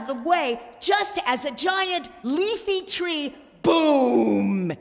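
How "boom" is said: louder, punched sound word; loud boom. A narrator's reading. Narrator voices the falling tree, loud boom. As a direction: land it like a crash.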